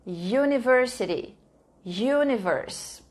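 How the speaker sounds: "universe" drawn out slowly, the "yu" start clear; slow teaching model. Shown as a demonstration. Teacher modelling words for the class, drawn out slowly. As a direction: stretch out "universe" so the "yu" is heard. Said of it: "começou com som de ya".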